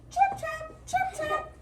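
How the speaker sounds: very high squeaky chirps; tiny hoof chirps. Acted. Teacher making the little goat's hoof sounds, very high.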